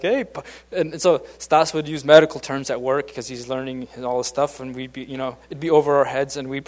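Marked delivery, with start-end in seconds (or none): 0.0-0.5 playful, raised teasing call. Retold or imitated. Older coworkers teasing young Stasik, calling him Pup. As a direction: tease the youngest with a bright little jab. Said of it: "we started to kinda, like, tease him".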